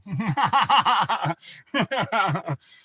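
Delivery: loud, high run of laughter; long rolling belly laugh; real hearty laugh. Real, not acted. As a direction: crack up at your own joke. Laughs at his pool boy joke.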